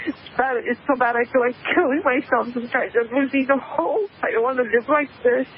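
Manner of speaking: despairing. Real, not acted. Caller confessing lost hope to radio host, despairing.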